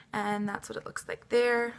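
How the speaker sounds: ordinary talk, nothing marked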